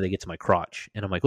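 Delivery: ordinary delivery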